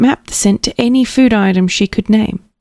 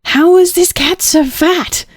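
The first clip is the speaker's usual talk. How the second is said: higher, slow, wondering; amazed inner voice; 11 semitones higher than usual. Acted. Louise marvelling at the fat cat, higher and slow. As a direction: let the disbelief stretch out slowly.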